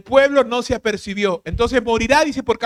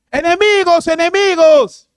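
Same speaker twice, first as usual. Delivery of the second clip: higher, louder alarm cry, slowing on the second; calling out; 6 semitones higher, 8 dB louder, slower than usual. Retold or imitated. Watchman crying out to the people that enemies come, a loud alarm. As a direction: shout the warning from the wall. Trumpet warning, watchman calls out.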